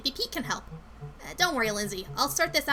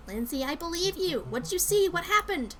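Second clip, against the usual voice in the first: ordinary talk, nothing marked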